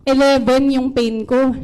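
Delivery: normal talking voice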